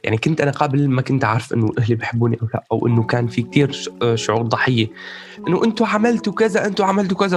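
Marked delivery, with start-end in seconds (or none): 5.5-7.4 accusing complaint. Retold or imitated. Voicing victim mentality toward parents.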